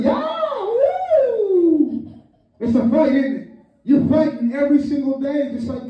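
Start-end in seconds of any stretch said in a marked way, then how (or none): 0.0-2.1 high kung fu cry with long falling wail; yelp, then let a high "woo" slide down; Bruce Lee. Retold or imitated. Preacher mimics a movie fighter, drawn-out martial-arts howl. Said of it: "Yeah, Bruce Lee".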